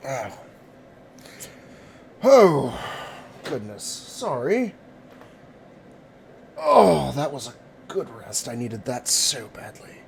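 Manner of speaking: sleepy waking stretch; yawning character just woken. Acted. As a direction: groan awake, then relieved. Waking after good rest.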